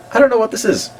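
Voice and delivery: very high-pitched puzzled voice; mock bafflement. Reacting to a strange homepage, high-pitched mock bafflement. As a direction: squeak it out, playfully clueless.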